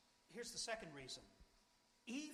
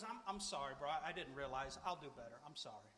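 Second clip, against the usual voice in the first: sheepish apology; 9 semitones lower than usual. Acted. Brother apologizing about the dead cat, low and contrite. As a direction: sink into a humble apology.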